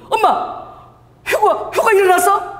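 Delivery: high, tearful daughter's voice; daughter crying. Retold or imitated. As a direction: cry out like a scared girl. Daughter crying in retold scene.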